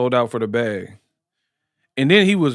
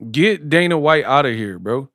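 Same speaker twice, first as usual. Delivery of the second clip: ordinary delivery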